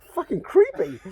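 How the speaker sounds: high, mock-outraged "Fucking creepy?"; indignant surprise. Driver reacting to hitchhiker's insult, mock indignant. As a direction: retell your own affronted disbelief, laughing. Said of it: "And I'm like".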